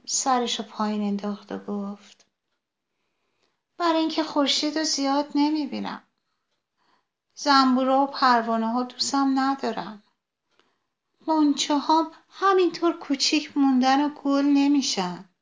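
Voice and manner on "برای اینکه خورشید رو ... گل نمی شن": higher, complaining little flower; a sunflower pouring out its woes, higher; sad sunflower. Acted. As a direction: sunflower explains why it is sad. Sunflower lowers head, complains.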